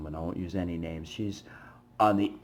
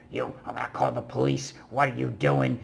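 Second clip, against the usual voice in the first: a raised, higher accusing yell; hammed-up shouting at the stranger who got blamed; mock angry yelling; 5 semitones higher than usual. Homeless woman yelling accusations at the narrator, mock yell. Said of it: "starts yelling at me".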